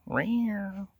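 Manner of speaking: high cat mew, trailing off; cat meow. Retold or imitated.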